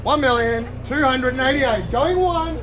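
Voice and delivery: loud, rising going once, going twice; auctioneer call. Real, not acted. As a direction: call the bids out to the crowd. Auctioneer calling the bids.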